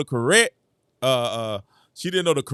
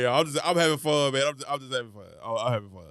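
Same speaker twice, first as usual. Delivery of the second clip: ordinary talk, nothing marked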